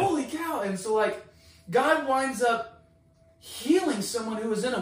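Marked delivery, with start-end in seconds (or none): 0.0-0.6 high, loud astonishment; amazed exclamation. Retold or imitated. Caller still stunned by the healing, amazed. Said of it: "I'm just like".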